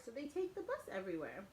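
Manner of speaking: ordinary delivery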